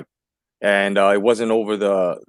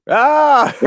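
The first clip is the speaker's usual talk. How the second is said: triumphant yell; 7 dB louder than usual. Real, not acted. Punchline of the joke lands.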